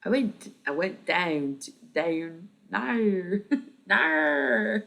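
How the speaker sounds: overdone Australian vowels on "down" and "no"; exaggerated Australian accent. Shown as a demonstration. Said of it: "in an Australian accent".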